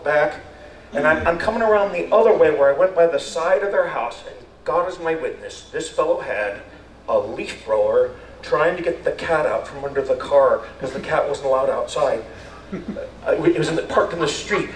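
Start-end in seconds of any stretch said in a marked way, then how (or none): none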